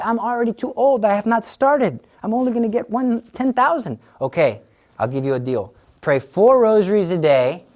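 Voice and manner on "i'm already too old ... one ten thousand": whiny excuse. Retold or imitated. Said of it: "You say".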